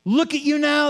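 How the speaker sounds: high, loud jeering taunt; mocking yell. Pastor voicing the jeering crowd at the cross, high sarcastic taunt. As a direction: sneer it up at him like the crowd. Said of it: "see the mockery, the sneering".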